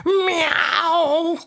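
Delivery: cat imitation meow. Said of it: "Do it again".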